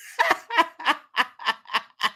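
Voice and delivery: real burst of laughter; cracking up hard. Real, not acted. Host laughing at a viewer calling it scandalous.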